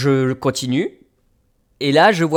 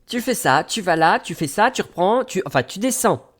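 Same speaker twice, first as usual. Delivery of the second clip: high-pitched mimicked list of orders; lady giving directions; 5 semitones higher than usual. Station lady listing steps to a lost traveller, imitated. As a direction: reel off her steps in a raised pitch. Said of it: "Elle me dit".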